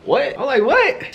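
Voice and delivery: jumps way up in pitch; a sudden high yelped what; shocked what. Retold or imitated. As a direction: squeak it in disbelief. Hearing she likes being looked at.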